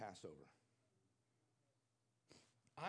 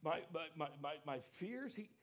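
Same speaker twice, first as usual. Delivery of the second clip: loud, higher preaching voice, pressing each phrase; full-force preaching; 4 semitones higher, 6 dB louder than usual. Acted. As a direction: preach it out to the congregation with fervor.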